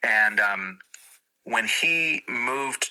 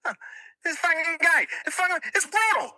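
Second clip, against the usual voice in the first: much higher, fast Boston growl of a comic; mock Bill Burr rant, fast and high; Bill Burr impression. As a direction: do Bill Burr ranting about something brutal. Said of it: "give me five words from Bill Burr".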